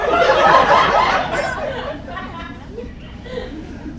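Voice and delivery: long high helpless laugh; high laughter. Real, not acted. Laughing at his own story.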